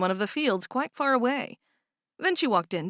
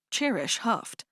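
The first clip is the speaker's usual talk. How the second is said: usual speaking voice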